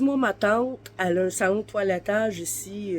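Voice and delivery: ordinary talk, nothing marked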